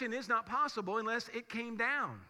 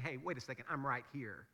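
gentle voice of God; 8 semitones lower, 7 dB quieter than usual. Retold or imitated. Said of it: "and he's saying".

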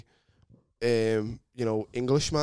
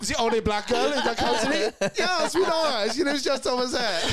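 high, laughing mock local voice; 11 semitones higher than usual. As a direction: squeak it out like nosy locals. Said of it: "They go".

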